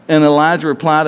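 ordinary talk

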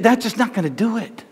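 ordinary talk, nothing marked

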